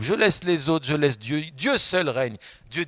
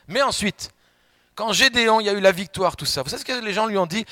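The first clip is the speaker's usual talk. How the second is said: excited build; 8 semitones higher, 5 dB louder than usual. Acted. Building to the question.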